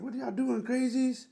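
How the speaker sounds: high-pitched imitation of a staff sergeant. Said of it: "I think one of the staff sergeants".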